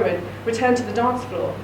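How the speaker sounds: usual speaking voice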